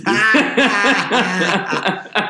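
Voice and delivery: bursting out laughing; big hearty laugh; loud laugh. Real, not acted. Laughs at job interview joke.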